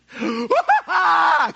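loud, high shriek like a little schoolgirl. Acted. Preacher imitates rugged manly disciples in terror, schoolgirl shriek. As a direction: shriek at the top of the lungs. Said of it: "they're shrieking like a five-year-old schoolgirl".

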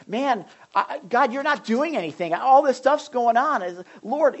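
high, loud complaint to God; exasperated prophet. Acted. Habakkuk complaining that God does nothing, exasperated. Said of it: "He's like".

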